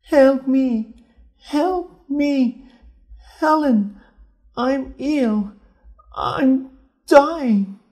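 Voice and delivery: high, loud, desperate cries for help; dying sister's cry. A narrator's reading. Dying Julia begging her sister for help, high and desperate. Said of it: "she was crying".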